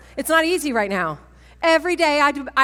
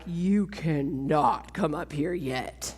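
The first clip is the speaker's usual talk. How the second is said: lower, slow, mock solemn God voice with a laugh; playful voice of God; 7 semitones lower, 5 dB quieter, slower than usual. Retelling the Lord turning her away, in a mock solemn voice. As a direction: lightly voice the Lord refusing her. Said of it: "The Lord was like".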